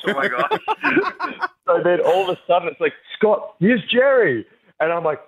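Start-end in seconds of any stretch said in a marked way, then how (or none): 0.1-1.5 high, giggling oh my gosh; laughing disbelief. Retold or imitated. Host retelling his shock at Jerry, laughing and high. 3.2-4.5 slow, much higher announcer-style intro; mock announcement. Host retelling how Jerry was introduced, slow and high.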